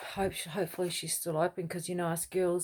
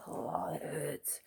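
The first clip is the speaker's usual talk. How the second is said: pained whisper; 2 semitones lower, 7 dB quieter, much slower than usual. Real, not acted. Hair pulling hurts.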